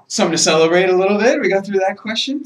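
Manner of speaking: loud, high-pitched relief. Real, not acted. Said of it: "Am I yelling".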